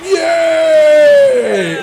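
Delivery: very high, loud mock cheer; crowd cheer. The crowd cheers Aisling, high and loud. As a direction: scream like a thrilled crowd. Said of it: "everybody's like".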